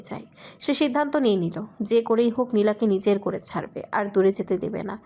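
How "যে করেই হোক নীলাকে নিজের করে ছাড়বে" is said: ordinary delivery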